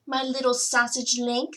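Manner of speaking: high, bright motherly voice; high doting mother voice. A narrator's reading. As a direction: gush like a proud mum. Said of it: "said Oscar's mother".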